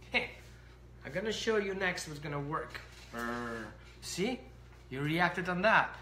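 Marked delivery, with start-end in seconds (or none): 3.1-3.7 dog growl. Retold or imitated.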